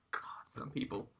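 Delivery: muttered under breath; whispered. Pretend. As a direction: whisper it in disapproval. Whispered aside voicing character.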